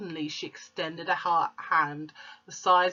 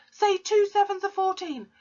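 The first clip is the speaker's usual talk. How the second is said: angry shout; 5 semitones higher than usual. Acted. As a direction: yell the threat. Shouting at dangling boy.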